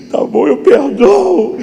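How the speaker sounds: high, loud, slow broken cry; crying surrender. Retold or imitated. Believer in prayer finally yielding to God, sobbing. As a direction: give in through tears, drawn out.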